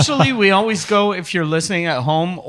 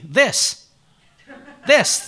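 "This" as a lone, deliberate word, then punched higher; demonstrating THIS; 5 semitones higher than usual. Acted. Boss teaching his employee to say "This", deliberate demonstration. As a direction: show how to say "This", pitched up on the repeat. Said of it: "that's how you say it".

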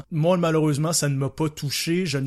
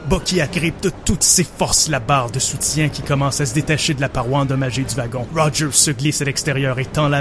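narrating an action scene over music; dramatic story narration; 8 dB louder than usual. Acted. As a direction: tell it like a movie trailer. Narrates his version of scene.

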